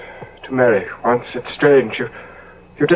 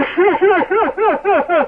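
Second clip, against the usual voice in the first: hysterical laugh; 12 semitones higher than usual. Acted. Dorian breaks into hysteria.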